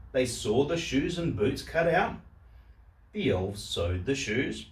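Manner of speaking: plain reading voice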